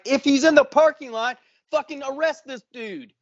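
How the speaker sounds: exasperated order, voice pitched high; high, impatient plea to the dispatcher; retold exasperated demand. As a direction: act out demanding action right now.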